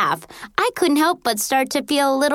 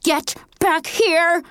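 slow, high, furious command; angry yell; 7 semitones higher, slower than usual. A narrator's reading. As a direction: yell it drawn out, pitched up. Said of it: "Missy yelled".

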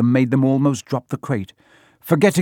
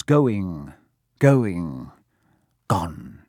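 fading away; 6 semitones lower, much slower than usual. A narrator's reading. Narrator watching Norbert vanish, slowly fading. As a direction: space out "going, going, gone", sinking each time. Dragon disappears into the distance.

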